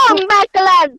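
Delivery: loud shocked yell; panicked scream. Real, not acted. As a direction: scream it out in fright. Car hits their character.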